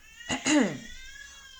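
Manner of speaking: high, loud showy throat clearing; pompous throat clear. Acted. Fox clears throat before proving himself, loud and showy. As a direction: clear your throat grandly. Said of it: "He cleared his throat".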